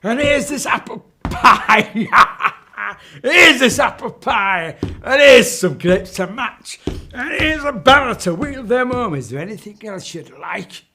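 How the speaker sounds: high, loud sing-song witch offering food; witch's sales pitch. Acted. Witch tempting the lost children with food, high and loud. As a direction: chant the menu like a cackling old witch. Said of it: "She went".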